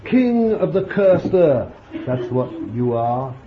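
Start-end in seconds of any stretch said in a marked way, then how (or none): 0.1-1.7 King drawn out high, louder and slow; mock proclamation. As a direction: proclaim it with scornful grandeur. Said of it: "even in their mockery".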